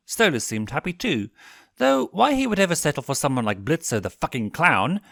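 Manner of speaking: ordinary delivery, nothing marked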